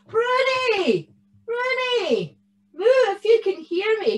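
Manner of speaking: high, carrying calls for a lost cow; shouted call. A narrator's reading. Parents shout for their missing cow, calling out. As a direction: call out long and high across the fields. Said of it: "they shouted for her".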